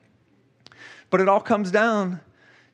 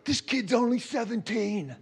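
awed; 4 semitones higher, 6 dB quieter than usual. Retold or imitated. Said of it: "like, crying".

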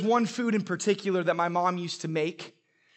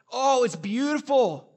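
mock delighted; 6 semitones higher, 5 dB louder, slower than usual. Seattle sunny day joke.

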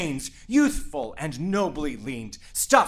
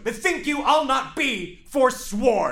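parting shout; 6 semitones higher than usual. Acted. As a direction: slam the door verbally.

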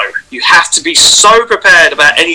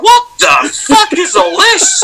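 high, slow, freaked-out shout; shocked yell; slower than usual. Retold or imitated. To masked friends, a high freaked-out yell. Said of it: "I was like".

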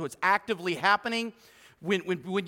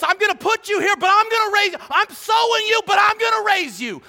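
preaching God's promise; 10 semitones higher, 6 dB louder than usual. Pretend.

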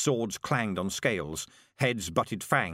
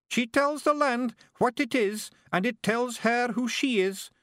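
tearful awe; 13 semitones higher, 4 dB louder than usual. Acted. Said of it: "tears running down his face".

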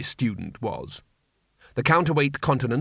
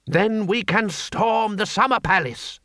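higher, slow stubborn declaration; revolutionary orator; 8 semitones higher, slower than usual. A narrator's reading. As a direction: proclaim it like a rally. Two Fire Herb replies.

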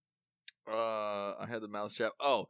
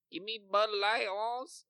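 high, put-on Indian accent saying the Bud Light line; imitating an Indian man's accent from the ad; mock Indian accent imitation; 11 semitones higher, slower than usual. Commercial's Indian student ordering beer in a mock Indian accent. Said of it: "the Indian guy's like".

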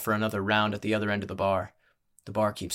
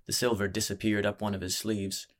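ordinary delivery

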